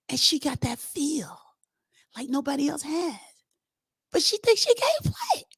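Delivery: hushed, high whisper; whispered aside. Retold or imitated. The groom confiding about her, in a whisper. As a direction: whisper it like a secret.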